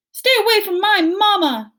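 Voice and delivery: high, loud protective shout; fierce cry. Acted. Gosling swooping in to defend his mama, shouting. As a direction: scream it to save your mother.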